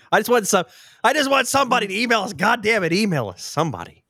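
high, loud, fast pleading outburst; exasperated plea. Pretend. Mock-desperate plea to listeners.

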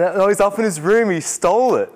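imitating a boy blurting it out; excited boy. Boy telling tutor Oscar stole it, high and eager.

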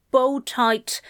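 plain reading voice

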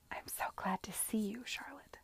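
soft whispered confiding; conspiratorial. Acted. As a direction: lean in and whisper a secret. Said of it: "she said conspiratorially".

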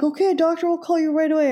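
high, fast, chirpy service voice; chirpy clinic voice. Retold or imitated. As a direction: mimic the cheerful clinic reply. Clinic voiced in retold story.

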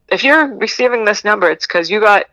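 lightly voiced recording announcement; mock recorded message. Reading out the recorded message the dating-number caller hears, mock recorded voice. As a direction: read it like a phone recording. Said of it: "the recording comes up".

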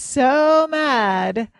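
"so mad" drawn out slowly and loud; stress on MAD. Real, not acted. Host marvelling at the character's temper, stretched "so mad". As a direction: lean long and hard on "so mad".

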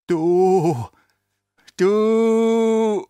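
choking dying stammer. Acted. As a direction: gasp the words out, dying. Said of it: "stammelte er".

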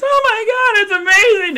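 very high, loud falsetto gush. Retold or imitated. Laura trying on the ring, squealing gush. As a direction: squeal with delight.

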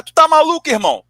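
high, loud indignant challenge; re-enacted pissed-off retort; retold angry outburst. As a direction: act out snapping back at him, voice jumping up. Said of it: "aí eu fiquei puto".

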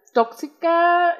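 tóxica said slow and high; stress on tóxica. Real, not acted. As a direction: stretch out tóxica. Stressing the key word. (tóxica lenta y aguda)

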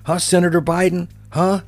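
mocking taunter. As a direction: needle him like a child with a toy. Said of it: "Someone could go".

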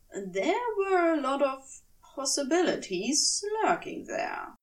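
usual speaking voice

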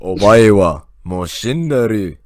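low, slow, menacing anime line; anime villain voice. Acted. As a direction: deliver it like a cold anime killer. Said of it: "thought that was an audio clip".

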